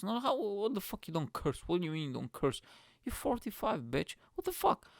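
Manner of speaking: high-pitched comic disbelief; incredulous outburst. Acted. Curser confronting forty-five-year-old non-curser, high incredulous rant. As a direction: challenge them in a high, baffled voice.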